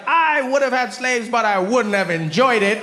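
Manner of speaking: high, slow, sorry tone. Retold or imitated. Woman at the exhibit confessing, slow and high. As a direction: confess it sweetly and earnest. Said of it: "She's like".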